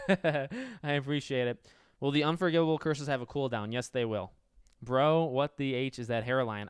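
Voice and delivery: ordinary delivery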